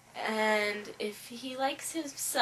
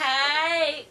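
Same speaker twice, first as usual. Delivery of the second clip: put-on drawled valley-girl hey, raised and louder; 4 semitones higher, 7 dB louder than usual. Shown as a demonstration. Showing how valley girls might talk, valley-girl drawl. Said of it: "if I drawl it out a little more".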